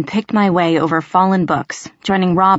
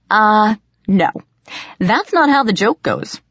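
higher-pitched, mock-correcting call; 4 semitones higher than usual. A narrator's reading. Rob correcting the fake Ethan's joke, calling out. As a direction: call it through the door, patronizing. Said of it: "called Rob".

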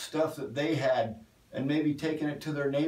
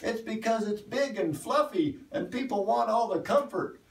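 higher-pitched mild mock yell from the back; 8 semitones higher than usual. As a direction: call it out playfully, voice up high. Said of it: "he yells out and he goes".

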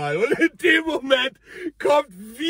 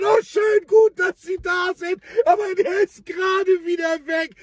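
relieved neighbour; 7 semitones higher than usual. Retold or imitated. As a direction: greet the crew with relief, pitched up. Anwohnerin begrüßt Rettungsdienst erleichtert. (erleichterte Anwohnerin, deutlich höher und lauter)

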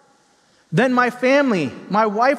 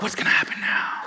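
scared whisper; 4 dB quieter than usual. Retold or imitated. Kid panicking, told again.

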